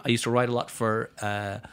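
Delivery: ordinary talk, nothing marked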